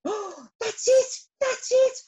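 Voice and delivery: high, loud cry of triumph; thrilled storybook bee exclamation; excited shout. A narrator's reading. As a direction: shout out the discovery. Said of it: "shouted Arthur".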